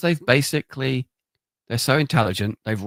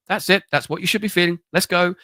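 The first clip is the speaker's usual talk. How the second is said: quick, eager go-ahead; a guide pleased it worked; excited urging; 6 semitones higher, 4 dB louder than usual. Retold or imitated. Retreat guide hurrying the preacher out, eager.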